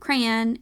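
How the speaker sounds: crayon as "cran", like man; one-syllable crayon. Shown as a demonstration. Listing the dictionary's second option, one-syllable crayon. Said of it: "options for the pronunciation".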